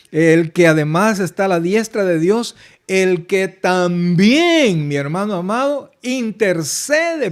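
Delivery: loud, emphatic preaching, stretching también; preaching shout. Real, not acted. Pastor to congregation, loud emphatic preaching. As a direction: proclaim it to the congregation.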